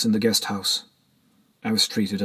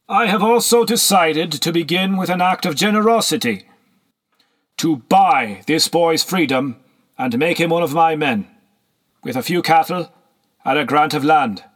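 higher, louder proclamation of a lord; triumphant benefactor; 6 semitones higher, 4 dB louder than usual. A narrator's reading. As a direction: announce a gift grandly to the room.

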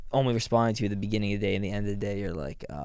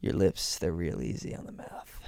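teasing whisper; 5 semitones lower, 5 dB quieter than usual. Pretend. Teasing innuendo.

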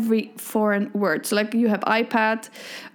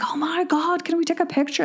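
gushing tourist; 7 semitones higher than usual. Retold or imitated. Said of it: "been like".

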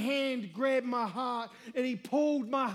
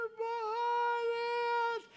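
dazed reply; 12 semitones higher, slower than usual. Retold or imitated. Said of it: "I go".

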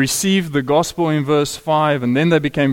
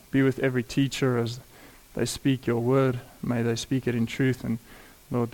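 prayer; 2 semitones lower, 6 dB quieter than usual. Acted. Leads a prayer.

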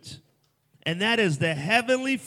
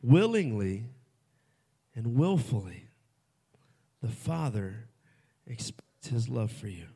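hushed repeat; 4 semitones lower than usual. Acted. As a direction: drop to a hush to make it land. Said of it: "I don't know if you understood that".

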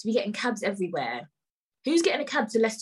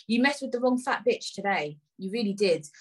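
ordinary talk, nothing marked

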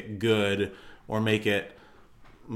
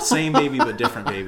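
laughing; 5 semitones higher, 6 dB louder than usual. Real, not acted. Joking, laughing.

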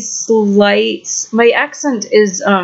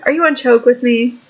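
ordinary delivery

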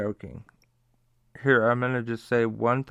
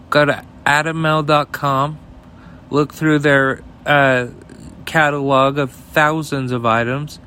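ad read; 2 semitones higher, 8 dB louder than usual. Acted. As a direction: sell it like a radio spot, leaning on the site name. Sponsor ad read.

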